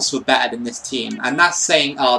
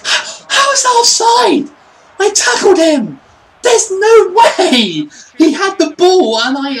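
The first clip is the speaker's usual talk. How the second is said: very high, loud screech of disbelief; real incredulous gamer shriek, drawn out; outraged shouting; 9 dB louder than usual. Real, not acted. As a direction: scream your protest at the call. Goal ruled offside, outraged.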